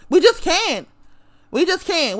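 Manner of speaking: ordinary delivery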